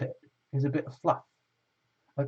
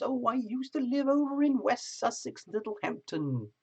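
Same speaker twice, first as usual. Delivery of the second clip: mock British chatter; 10 semitones higher than usual. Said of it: "Brits, chatting amongst yourselves".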